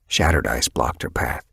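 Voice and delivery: plain reading voice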